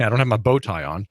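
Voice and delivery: ordinary talk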